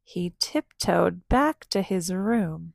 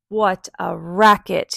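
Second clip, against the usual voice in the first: louder, exasperated cry; loud exclamation; 9 dB louder than usual. Acted. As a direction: call it out, fed up with the noise. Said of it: "he cried".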